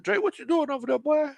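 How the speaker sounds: high-pitched teasing call-out; mock scolding. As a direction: playfully call him out, voice jumping up. Teasingly scolding a flirty comment.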